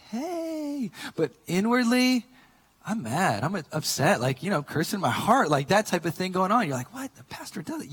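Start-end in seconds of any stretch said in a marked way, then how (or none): 0.1-0.9 high, soft fake-nice "hey"; feigned friendliness. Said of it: "maybe outwardly I'm like". 6.9-7.9 high, soft shocked listener; mock disbelief. Imagined shocked congregation voiced.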